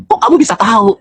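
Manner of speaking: high-pitched, startled question; imitated surprise. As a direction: mimic someone taken aback. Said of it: "orang itu kaget gitu loh". (menirukan orang kaget, nada tinggi)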